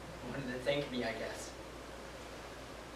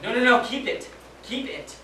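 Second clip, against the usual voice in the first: higher and louder, quick repeated no, fading to a breath; urgent refusal; 7 semitones higher, 5 dB louder than usual. Retold or imitated. Soldier refusing prisoner's ring, urgent then breathy. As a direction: push the ring back, pleading him to keep it.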